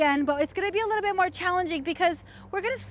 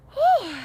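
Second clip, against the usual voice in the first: relieved whew; 11 semitones higher, 7 dB louder than usual. Real, not acted. Exhale after hard set.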